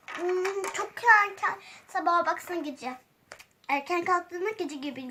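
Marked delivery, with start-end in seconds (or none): none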